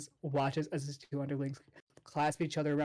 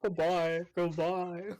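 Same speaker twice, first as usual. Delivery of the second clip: war wife farewell; 3 semitones higher than usual. Acted. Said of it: "the wives that would wait".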